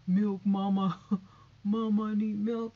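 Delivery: put-on loud whiny baby calling for milk; loud pretend baby begging for milk; baby wanting milk. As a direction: wail like a hungry baby.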